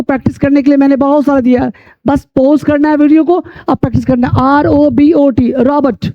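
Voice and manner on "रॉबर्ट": "रॉबर्ट" with the first O opened to AH; O pronounced AH. Shown as a demonstration. Teacher models the word for practice, "रॉबर्ट" with an AH sound. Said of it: "ओ का उच्चारण आ हो रहा है".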